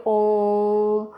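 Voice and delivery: very slow, stretching ओम and राम; prolonged calling tone on ओम and हे राम; drawn-out pluta vowels. Shown as a demonstration. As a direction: hold each vowel long, as if calling someone far away. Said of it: "किसी को दूर से बुलाने के लिए".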